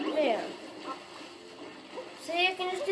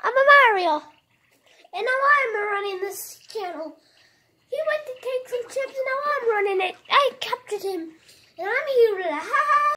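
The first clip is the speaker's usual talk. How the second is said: higher, louder villain-Mario gloat; playful Mario; 5 semitones higher, 5 dB louder than usual. Pretend. Mario boasting to viewers he took the channel. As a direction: gloat like the captor.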